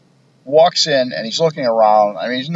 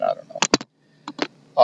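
muttered aside; 4 dB quieter, much slower than usual. Real, not acted. Aside about FBI agents' looks.

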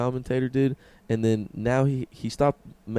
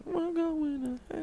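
high slow voice; 9 dB quieter, slower than usual. Pretend. Joking shared remark.

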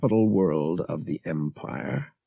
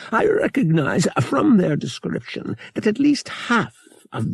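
put-on lightly raised, fussy old man; a hint of a fussy old trader; old dealer voice; 7 semitones higher than usual. A narrator's reading. Narrator voices old dealer O'Connor.

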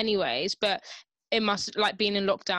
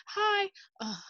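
mock chirpy vlog hello, pitched way up; imitating a bright greeting; 12 semitones higher than usual. Imitating the influencer filming for her page, a high mock greeting. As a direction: lightly imitate an influencer starting a video. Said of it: "and she's like".

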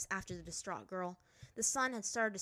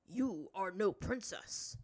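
stern master voice; 4 dB louder, slower than usual. A narrator's reading.

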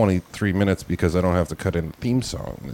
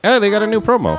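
mock producer voice; 13 semitones higher, 5 dB louder than usual. Imitating producers, loud high.

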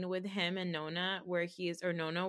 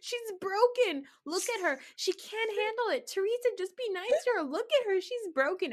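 hammed-up wail of concern, pitched way up; Melissa; 12 semitones higher, 4 dB louder than usual. Castmate fretting over crying friend, mock high-pitched pleading. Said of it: "Melissa just keeps being like".

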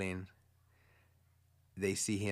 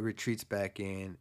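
ordinary delivery

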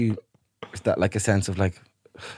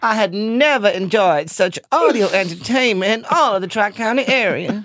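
Southern belle; 4 semitones higher than usual. Pretend. Cohost improvising as a Southern belle. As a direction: play a genteel Southern belle at the cotillion.

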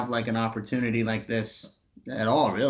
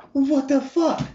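shocked; 13 semitones higher, 7 dB louder, slower than usual. Retold or imitated. Shocked at needing MTV approval.

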